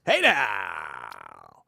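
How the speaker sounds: very low, drawn-out hey now; Howard Stern "hey now". Retold or imitated. Host opening a Monday show, very low drawn-out catchphrase. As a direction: sink deep and stretch it out like Howard. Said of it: "Greatest words you could hear".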